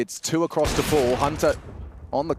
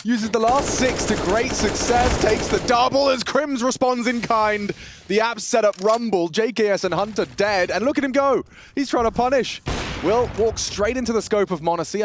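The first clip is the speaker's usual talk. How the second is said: higher, rapid play-by-play call; esports caster; 9 semitones higher than usual. Acted. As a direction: call it live and hyped. Play-by-play at high pitch.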